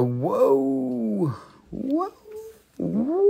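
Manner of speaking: real delight at what he sees; surprised whoa. Real, not acted. Crystal seller amazed by the stone, high surprised whoas.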